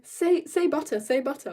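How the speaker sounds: imitating a nagging boy; pestering kid. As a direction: pester like a kid egging someone on. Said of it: "he just kept going".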